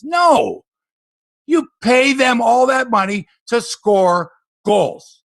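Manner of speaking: furious at the overpaid stars; fed up, slow and forceful; angry outburst. Real, not acted. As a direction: shout No high and loud, then slow and hammered. Angry hockey rant.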